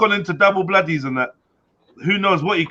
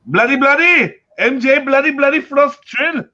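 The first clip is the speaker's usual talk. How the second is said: higher, louder road-rage style shout; mock bloody shoutout; 8 semitones higher, 4 dB louder, slower than usual. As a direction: yell the names like the raging man. Shouting out viewer names.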